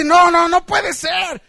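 high, loud protest in disbelief; indignant, amazed shouting; astonished mechanic. Acted. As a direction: demand an explanation, acting stunned. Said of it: "el mecánico se quedó sorprendido".

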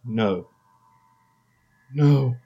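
building from dread to scream; horrified scream. Acted. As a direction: realize the nightmare, then scream. Horror realization.

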